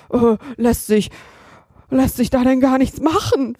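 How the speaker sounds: hesitant, worried robber asking for help; anxious robber voice. Acted. Robber Hotzenplotz anxiously asks Kasperl for help, worried. Robber fears mushroom poisoning.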